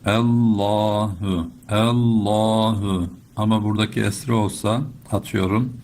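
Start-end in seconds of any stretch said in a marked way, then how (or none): 0.1-3.1 "Allahu" with a heavy, thick lam, held slow. Shown as a demonstration. Teacher demonstrates the thick lam of "Allahu", slow. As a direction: stretch "Allahu" and darken the double l. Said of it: "kalın okuyoruz ve kalın bağlıyoruz".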